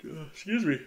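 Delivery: yawning mid-sentence. Real, not acted. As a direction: talk through a yawn. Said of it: "You're yawning with my pick?".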